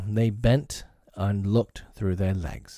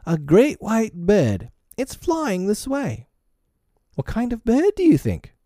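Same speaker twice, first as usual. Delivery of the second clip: excited wonder; 12 semitones higher than usual. A narrator's reading. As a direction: marvel aloud like an amazed child. Boy excitedly reports wondrous bird.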